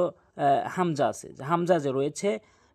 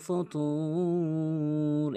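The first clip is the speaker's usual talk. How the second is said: slow, higher, drawn-out tajweed chant; Quran recitation; 3 semitones higher, much slower than usual. Shown as a demonstration. Teacher recites the verse for learners, measured Quran recitation. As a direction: recite the verse with long held vowels.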